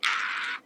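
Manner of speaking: loud, high, unvoiced "Ah!"; triumphant squeal after a petty jab; gleeful shriek. Real, not acted. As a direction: let out a gleeful cry.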